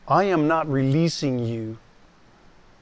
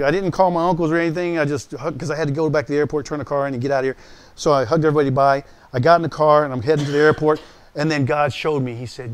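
usual speaking voice

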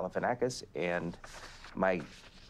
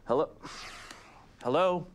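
mock phone answering; 7 semitones higher than usual. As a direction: call out a raised hello into the phone. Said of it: "This is Vincent Van Gogh answering his cell phone".

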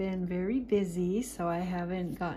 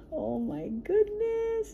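high, drawn-out delighted coo; delighted squeal; 12 semitones higher, slower than usual. Real, not acted. Collector unboxing her tiny doll, delighted squeal. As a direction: let it soar up slowly in wonder.

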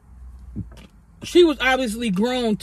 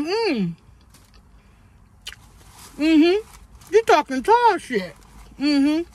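sassy; 4 semitones higher, much slower than usual. Retold or imitated. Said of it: "I'm like".

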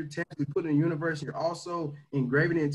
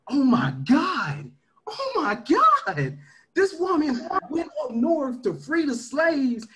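fired up; 8 semitones higher than usual. Real, not acted. Hyping up his team about Harriet Tubman, much higher and amazed. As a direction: build from awe into a challenge.

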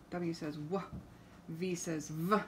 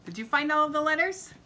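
excited; 10 semitones higher, 9 dB louder, much faster than usual. Acted. Cheerful question to children.

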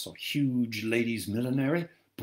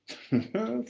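high teasing giggle; naughty laugh; 9 semitones higher than usual. Acted. Restored ghost woman laughing naughtily at the narrator. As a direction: laugh with a knowing, naughty edge. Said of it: "gave a throaty, naughty laugh".

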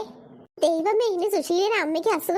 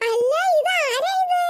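higher, slow, drawn-out surprise; surprised neighbour; 5 semitones higher, slower than usual. Acted. Neighbour Susheela surprised to find the family at her door. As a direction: greet the unexpected visitors with a stretched wonder.

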